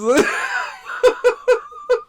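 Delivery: high, bursting laugh; a real high cackle. Real, not acted. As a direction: squeal with laughter.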